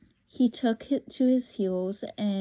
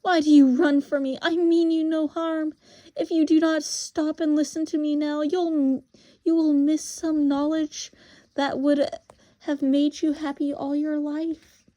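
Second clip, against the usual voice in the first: high, insistent witch voice; imperious witch; 6 semitones higher, 5 dB louder than usual. Acted. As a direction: press the boy to stop and listen, pitched high. Voices the witch tempting Diggory.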